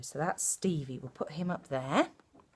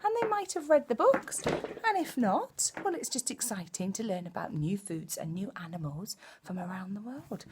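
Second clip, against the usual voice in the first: put-on reading to children; 5 semitones higher than usual. Storyteller greeting children at the start of a reading, hushed. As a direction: draw the little ones in gently.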